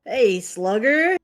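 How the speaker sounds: loud, hearty voice message; Eunice. Acted. Game master voicing a reply to the player, loud and lively. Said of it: "you hear his voice go".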